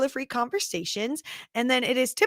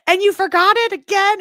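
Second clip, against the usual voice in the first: mock exasperated teasing, higher and louder; mock exasperation; 10 semitones higher, 8 dB louder than usual. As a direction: play the chat scolding her with fake outrage. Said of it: "everyone was like".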